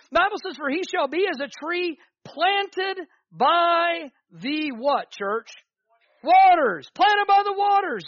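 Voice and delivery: high, loud call-and-response verse; loud scripture preaching. Real, not acted.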